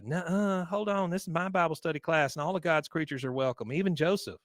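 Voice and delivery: put-on high-pitched perky young woman act; imitated girlish protest; protesting niece. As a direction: object brightly, standing up for your class. Luann objects, defends her class.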